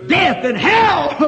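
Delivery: joyful revival shout; preaching climax. Real, not acted. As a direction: lift the room as you shout.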